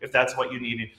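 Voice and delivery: ordinary talk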